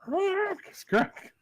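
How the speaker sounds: high sharp squawks; bird squawk. Acted. GM voicing the bird pecking the player's face, high squawks. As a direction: screech as the bird attacks.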